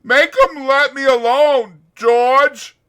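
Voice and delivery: loud, high, desperate begging; Lenny begging George, terrified; terrified plea. Acted. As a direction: beg for rescue. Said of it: "he cried".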